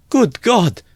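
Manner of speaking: very high, loud exclamation; alarmed cry. A narrator's reading. Mother terribly alarmed by her son's farewell, crying out. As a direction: cry out in shock, pitch leaping up. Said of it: "cried Pulcheria Alexandrovna".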